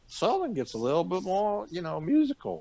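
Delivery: sing-song Southern American drawn toward British; musical Southern accent. Shown as a demonstration. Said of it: "it gets a little bit more British".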